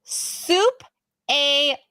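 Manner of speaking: slow, word-by-word American French; flat-out American reading, long gaps between words; most American ever. Shown as a demonstration. Demonstrating French dish for fun, most American accent ever. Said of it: "most English American ever".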